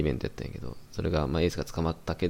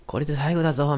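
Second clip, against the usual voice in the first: imitated off-mic shout; 8 semitones higher than usual. Podcaster recounting the rapper's last off-mic moment, imitated shout. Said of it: "マイクなしで大声出して".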